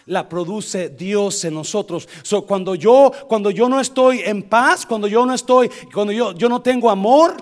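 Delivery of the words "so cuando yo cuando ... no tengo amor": loud, raised sermon voice; high, forceful pulpit delivery with suspended endings; insistent preaching. Real, not acted. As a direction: build each repeated phrase toward the congregation.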